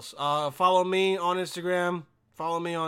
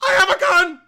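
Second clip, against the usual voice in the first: high, very loud robber's yell; acted high-pitched holler; screamed threat; 12 semitones higher, 14 dB louder than usual. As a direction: bellow the threat at full force. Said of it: "don't just scream out".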